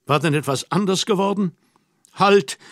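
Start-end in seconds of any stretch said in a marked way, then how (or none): 2.1-2.6 sudden loud, pleading call of halt; flehender Ruf. Acted. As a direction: cry out halt to stop her leaving. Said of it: "rief ich flehend". (flehend laut „Halt“ rufen)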